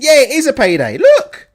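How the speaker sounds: hammed-up gloating boxer, loud and high; mocking Chisora's glee. Host mimicking Chisora's tweet to his viewers, mock gleeful. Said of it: "Chisora saying".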